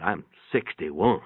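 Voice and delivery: ordinary delivery, nothing marked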